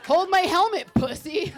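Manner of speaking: hammed-up macho swagger; macho taunt. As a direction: toss it off like a daredevil. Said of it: "the dude who's like".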